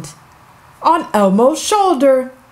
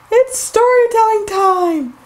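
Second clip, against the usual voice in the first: high, bubbly welcome to the show; excited host greeting; 7 semitones higher than usual. Acted. Host welcoming kids back, bright and excited.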